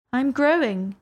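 put-on meek girl's voice. A narrator's reading. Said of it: "said Alice very meekly".

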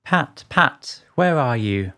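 low, irritated call for a servant; deep-voiced master, annoyed; angry Rabbit. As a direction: summon him crossly in a deep voice. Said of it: "Next came an angry voice".

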